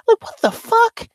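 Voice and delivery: very high, squeaky disbelief; incredulous. Real, not acted. Streamer reacting to the posh accent he imitated, incredulous. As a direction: let the voice leap up in bafflement.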